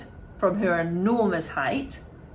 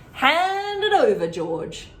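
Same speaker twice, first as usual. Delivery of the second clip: demanding grandma; 8 semitones higher, 6 dB louder, slower than usual. A narrator's reading.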